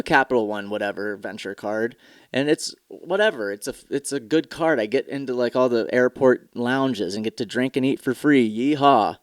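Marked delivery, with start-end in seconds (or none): none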